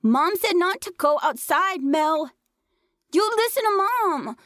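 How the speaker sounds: put-on high child's voice, frantic warning; a six-year-old repeating Mom's rule in panic; shrieking little boy. A narrator's reading.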